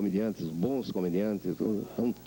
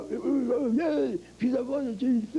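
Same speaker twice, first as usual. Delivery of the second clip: high, slow put-on Hunchback voice; a dubber shows off the character voice he built for the Hunchback; 14 semitones higher, slower than usual. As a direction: slip into the strained Hunchback of Notre Dame voice. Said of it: "fez o Corcunda falando com a voz dele".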